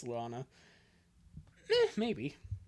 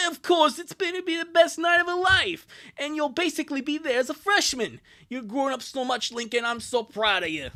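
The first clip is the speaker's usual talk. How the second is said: high, excited start, easing as it goes; proud older sibling; 9 semitones higher, 5 dB louder than usual. Acted. Older sibling to Lincoln before Luna's big night, proudly gushing. Voices comic dialogue.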